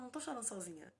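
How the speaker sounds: ordinary delivery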